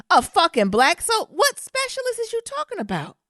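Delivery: high, disbelieving squawk; can you believe this, indignant; outraged disbelief at airport security; incredulous outrage. Real, not acted. Outraged at specialist being called.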